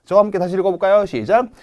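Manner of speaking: usual speaking voice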